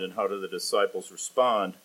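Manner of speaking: ordinary talk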